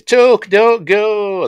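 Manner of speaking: very high, loud, slow hammed-up plea; T J pleading. T J running out to stop Tuck leaving, hammed-up high plea. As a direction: drag it out like a melodrama heroine.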